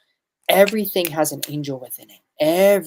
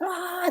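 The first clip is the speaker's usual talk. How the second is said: high cry; 6 dB quieter than usual. Retold or imitated. Devil shaking before explosion.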